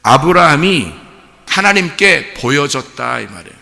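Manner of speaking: loud, high, stretched-out preaching; climactic preaching. Real, not acted. Pastor proclaiming Moriah to the congregation in climax.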